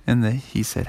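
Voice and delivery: ordinary delivery, nothing marked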